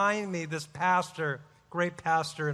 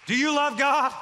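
called out high and louder; retold yell; 6 semitones higher, 4 dB louder than usual. Pastor yelling a question at Randy on the bus, shouted. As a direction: demand an answer across the bus. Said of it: "this guy's yelling on the bus".

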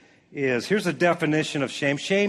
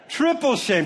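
mock scolding; 8 semitones higher than usual. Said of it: "those words that say".

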